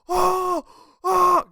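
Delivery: high, loud excited cries of oh; reliving the thrill, yelping in his seat; freaking out. Retold or imitated. Fan in the cinema seat reliving the moment, loud excited yelps. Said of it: "like, freaking out".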